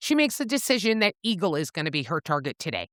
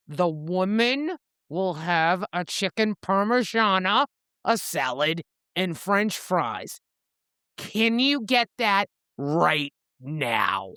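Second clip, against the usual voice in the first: slow, deliberate, mildly menacing retold order; a tad aggressive; slower than usual. Reaper orders from the snooty waiter again, a tad aggressive. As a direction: spell out the order firmly, word by word. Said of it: "Reaper gets a tad aggressive".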